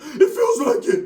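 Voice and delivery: hurt primo telling his cousin on the phone, weepy; high, slow, crying whine. Retold or imitated. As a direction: answer through a sob.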